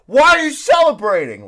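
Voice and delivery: loud, high exasperated yell. Retold or imitated. Wrestling fan as a kid to the TV, exasperated yell. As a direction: re-enact an incredulous shout at the screen. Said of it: "I was yelling at my T V".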